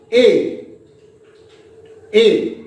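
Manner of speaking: "A" said as a long open Hindi aa, not the vowel in apple; teacher demonstrating the long Hindi "A", not as in apple. Shown as a demonstration. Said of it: "It's not A for apple".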